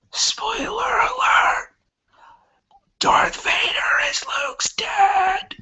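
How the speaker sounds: very high whispered cry, slow; squeaky whispered cry. Pretend. Shrieking a fake spoiler to the viewers. Mock dramatic spoiler reveal.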